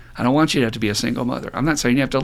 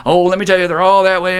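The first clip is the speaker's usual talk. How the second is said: higher, louder catty mock gossip; gossipy mock voice; 6 semitones higher, 6 dB louder than usual. Mocking women who trash men in a higher, louder gossipy voice. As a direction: play a woman bad-mouthing men.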